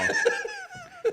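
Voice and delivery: high squeal of a laugh; real amused laugh; high burst of laughter. Real, not acted. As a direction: laugh at the punchline. Laughing at his own story.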